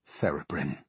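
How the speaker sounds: low, soft rasp. A narrator's reading. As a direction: rasp the name out grimly. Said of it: "the Archdruid rasped".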